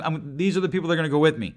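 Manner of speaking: ordinary delivery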